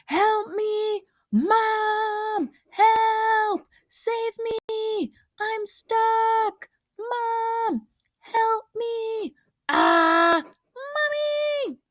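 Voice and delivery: high, loud panicked cries; shouting for help. Acted. Stuck boy on the clothesline shouts for Mom, panicked and loud. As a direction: yell for Mom as if dangling in midair. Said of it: "So I shout for help as loud as I can".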